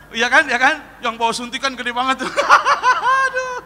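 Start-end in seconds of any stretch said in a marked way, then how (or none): 2.4-3.2 high, loud cackle of a crazy doctor; mock manic laugh. Preacher mimicking doctor with huge syringe, high mock cackle. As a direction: imitate a comic film doctor cackling.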